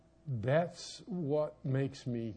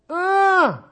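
sharp high startled yelp, retold; startled scream; 17 dB louder than usual. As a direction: shriek in sudden fright.

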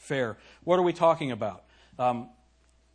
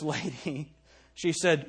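ordinary talk, nothing marked

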